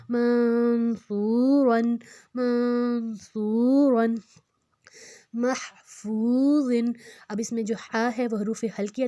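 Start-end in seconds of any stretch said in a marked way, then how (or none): 0.1-4.1 slow منصورن, humming n then long, louder "soo"; nasal ghunna. Shown as a demonstration. Teacher repeats ghunna for learners, nasal drawn-out منصورن. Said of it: "اس میں ہم غنہ کریں گے". 5.3-6.8 slow محفوظن with an audible h and long "foo"; clear h. Shown as a demonstration. Teacher contrasts letters for learners, distinct h in محفوظن. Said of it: "ہا کی آواز تھوڑی".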